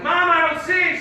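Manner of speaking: high, loud call to Mom. Retold or imitated. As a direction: holler it to someone in another room. Calls to mom from upstairs.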